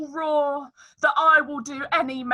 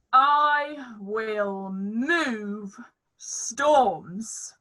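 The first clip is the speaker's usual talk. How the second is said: grand actor; much slower than usual. Acted. Bottom hams up his acting.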